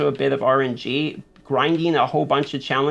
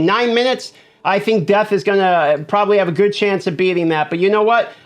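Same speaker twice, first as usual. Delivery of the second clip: high, loud whooping after the win; victory shout; 6 semitones higher, 5 dB louder than usual. Real, not acted. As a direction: cheer it out, thrilled. Celebrating clearing the rift.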